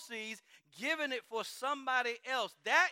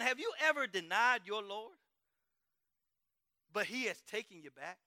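usual speaking voice

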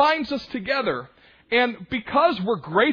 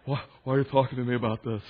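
mock defensive whine; 10 semitones lower, 4 dB quieter, much faster than usual. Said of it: "Some of it was you".

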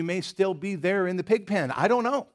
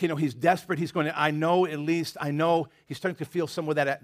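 ordinary talk

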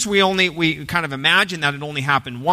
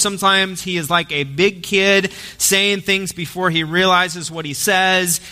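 ordinary talk, nothing marked

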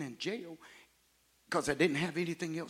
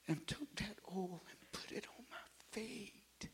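hushed whisper; 5 semitones higher, 10 dB quieter than usual. Acted. Preacher telling the congregation, whispered. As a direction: whisper it, unvoiced and soft.

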